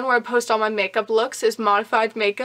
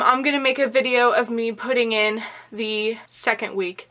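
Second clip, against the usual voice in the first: ordinary talk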